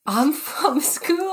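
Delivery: put-on high, very slow, mangled English accent; hammed-up botched English accent; failed English accent. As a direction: drag out a hopeless English accent. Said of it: "And I couldn't do the accent".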